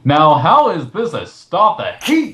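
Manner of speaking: ordinary delivery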